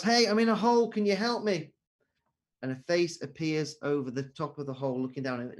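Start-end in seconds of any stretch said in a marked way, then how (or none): 0.0-1.7 higher, much louder cry for help; trapped man grows desperate and shouts up, higher and louder; desperate acted shout. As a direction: call up out of the pit as night falls. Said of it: "and he shouts".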